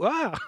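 short sharp scream of fright; startled yelp. Real, not acted. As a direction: jump and yelp in surprise. Startled by a sudden boo.

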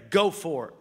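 "Go for it" punched loud; emphatic. Shown as a demonstration. Preacher leading everybody to say it right now, loud emphatic "Go for it". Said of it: "say with emphasis".

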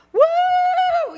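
very high, loud whoop of delight; child's excited whoop. Retold or imitated. Grandkids hearing they got a prize, whooping with delight. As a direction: whoop like a kid who just got a prize.

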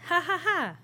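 ordinary delivery

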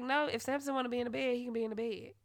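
fast quoted rule. Retold or imitated. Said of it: "they used to be like".

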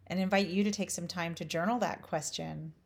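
normal talking voice

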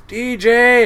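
high, loud summoning shout; calling out. Retold or imitated. Skipper summoning a deckhand to the wheelhouse, calling out loudly. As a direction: holler for a crewmate across the boat.

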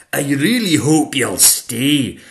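loud, emphatic schoolmistress; welcoming dragon teacher. Acted. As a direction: invite the horse grandly. Madam Dragon invites horse.

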